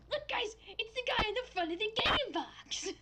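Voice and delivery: high, excited squeal. Real, not acted. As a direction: point him out with delight. Excited at spotting character.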